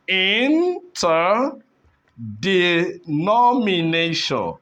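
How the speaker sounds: "interdenomination" slowly, first syllables stretched with gaps; teacher slowly sounding out "interdenomination". Shown as a demonstration. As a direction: break "interdenomination" into in, ter, de, nomination. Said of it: "if you take your time to split this".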